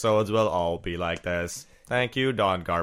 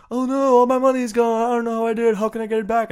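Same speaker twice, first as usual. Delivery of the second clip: high, loud panicked whine; a mock wail of lost money; host mocking a crypto victim, whining high; whiny victim; 12 semitones higher, 7 dB louder, faster than usual. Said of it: "is like".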